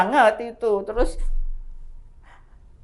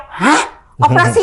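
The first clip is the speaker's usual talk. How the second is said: high, louder startled question; shocked disbelief; 4 semitones higher, 4 dB louder than usual. Retold or imitated. Patient told by doctor of surgery, startled disbelief. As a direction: re-enact being floored by the news.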